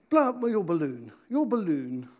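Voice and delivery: usual speaking voice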